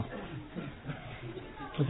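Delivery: a long hushed whisper; drawn-out whisper. Shown as a demonstration. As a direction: whisper it very slowly. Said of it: "He wants to wear the t-shirt also".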